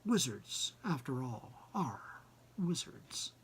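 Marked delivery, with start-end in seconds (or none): none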